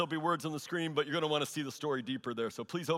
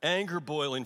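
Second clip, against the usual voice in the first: usual speaking voice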